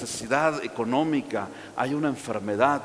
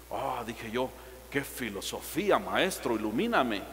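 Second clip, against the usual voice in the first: mock awe; 4 semitones lower, 8 dB quieter than usual. Mocking the man's excuse.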